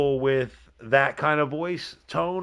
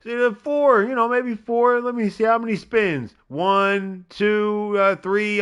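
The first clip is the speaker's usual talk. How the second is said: excited counting; 6 semitones higher than usual. Real, not acted.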